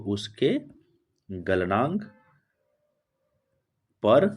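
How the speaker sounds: word by word with long pauses; slow dictation. Real, not acted. Teacher dictating a definition to students, slow dictation. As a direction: dictate slowly, leaving time to write.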